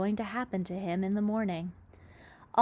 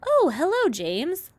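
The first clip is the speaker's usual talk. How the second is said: sweet greeting; 9 semitones higher, 8 dB louder than usual. A narrator's reading. Glowworm greets James sweetly.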